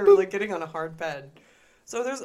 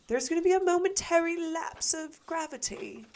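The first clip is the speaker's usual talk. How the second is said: high-pitched, amateur British announcer; rough British accent, pitched up; British accent voiceover; 10 semitones higher than usual. Retold or imitated. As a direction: imitate a British safety announcement, not very well. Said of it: "except in a better British accent".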